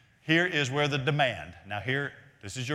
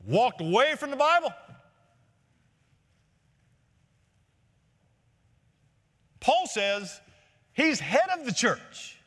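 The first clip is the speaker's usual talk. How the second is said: higher, louder, driving pulpit cadence; fervent preaching; 6 semitones higher, 5 dB louder than usual. Acted. Pastor to congregation about true churches, preaching at full force. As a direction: press every phrase home like a revivalist.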